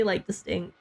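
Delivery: usual speaking voice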